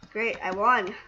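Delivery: usual speaking voice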